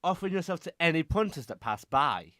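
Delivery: loud, high, furious retort; angry shout. Acted. Tony shouting back at Foxy in a furious quarrel. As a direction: yell the insult right back at her.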